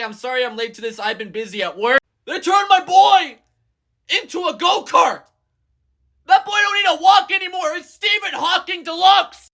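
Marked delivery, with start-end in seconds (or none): 2.3-9.5 excited. Real, not acted. Fan reacting to Starscream's tank body, high excited exclaiming. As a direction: gush about it like a thrilled fan.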